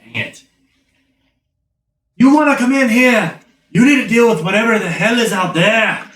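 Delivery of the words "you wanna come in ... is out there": loud, high-pitched guard voice; demanding guard. Acted. Guard bargaining with strangers outside, demanding. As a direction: call out a stern demand through a door.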